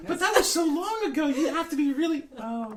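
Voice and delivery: very high put-on voice, breaking into laughter; in-character amazement. Plays a character marvelling at age.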